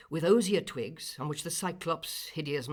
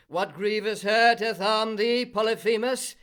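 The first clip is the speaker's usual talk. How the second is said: higher, louder call from outside; calling Cyclopes; 6 semitones higher, 10 dB louder than usual. A narrator's reading. Cyclopes outside call to Polyphemus, loud and high. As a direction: shout in to the wounded giant.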